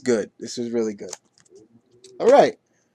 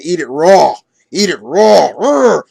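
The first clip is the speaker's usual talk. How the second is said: eager shout with growl; 5 dB louder than usual. Retold or imitated. Tarzan voiced insisting on raw food.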